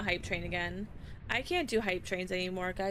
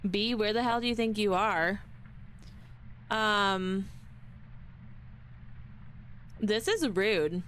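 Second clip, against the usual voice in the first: normal talking voice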